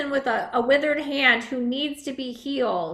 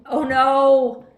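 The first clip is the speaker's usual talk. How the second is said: mock dismay; 7 dB louder than usual. Aurora sees hurt paw.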